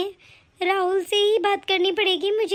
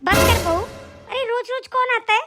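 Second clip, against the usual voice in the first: mock scolding; 6 semitones higher, slower than usual. Mother-in-law scolds the bahu.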